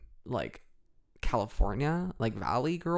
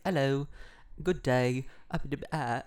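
muddled mock British accent; British accents blended; 3 semitones higher than usual. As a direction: jumble British accents into one greeting. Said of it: "like, British English accents".

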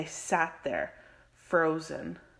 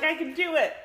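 mock confident; 12 semitones higher, 7 dB louder, faster than usual. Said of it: "I was like".

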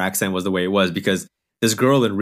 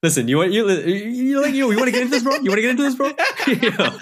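much higher, playful trash talk breaking into laughs; laughing mock challenge; 13 semitones higher than usual. Challenging cohost over Veep, laughing mock trash talk. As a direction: hype up a fake wrestling threat while cracking up.

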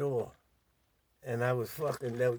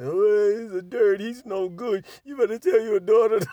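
mock wailing complaint, higher and louder; mock hollering; 6 semitones higher, 7 dB louder than usual. Two girls complaining to the mother, mock hollering. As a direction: imitate the girls hollering, half laughing. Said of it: "start hollering and crying".

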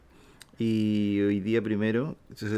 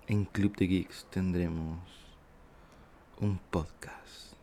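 spooky whisper; 4 semitones lower, 12 dB quieter than usual. Pretend. Spooky whisper announcing the episode.

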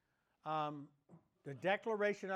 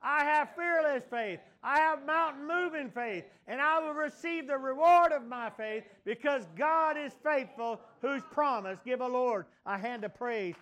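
higher and louder with every line, building to a roar; rising shouted declaration; 5 semitones higher, 7 dB louder than usual. Real, not acted. Pastor leading the church in the final declaration, shouting. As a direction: shout each promise like a victory cry. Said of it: "say it all together one more time".

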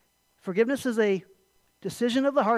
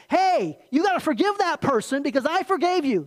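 loud, higher, pushy scolding demand; demanding God; 6 semitones higher, 5 dB louder than usual. Retold or imitated. Pastor showing how God does not speak, loud scolding demand. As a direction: bark the order like a stern boss. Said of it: "he doesn't come along and say".

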